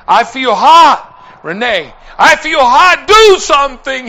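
loud, high retold cry; imitating an agonized plea; desperate begging. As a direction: beg for relief, crying out. Retells dying man begging for relief.

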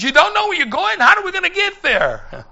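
high, loud imitation of an exasperated driver; alarmed disbelief. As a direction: act out a driver's alarmed outburst. Driver upset at narrator not knowing route.

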